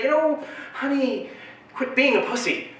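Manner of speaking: sweeten it then cut her off; mock sweet dismissal. Dad brushing off his crying daughter, mock sweet. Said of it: "I just say".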